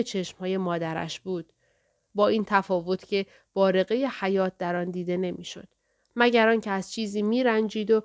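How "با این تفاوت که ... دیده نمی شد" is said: usual speaking voice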